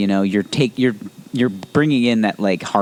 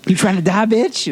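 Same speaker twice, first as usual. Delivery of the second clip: mock tough guy; 9 semitones higher than usual.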